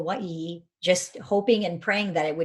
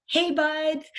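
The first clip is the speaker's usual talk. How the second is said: high, drawn-out, dopey "Hey, Bud"; surfer-dude greeting; 11 semitones higher than usual. Retold or imitated. Teen coach greeting older teammates, high surfer-dude voice. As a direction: channel a stoner surfer saying hi. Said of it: "I'd be like".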